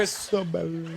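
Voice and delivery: soft, tentative go at a name; hesitant attempt. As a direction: trail off softly, unsure of the name. Timid repeat of unsayable name.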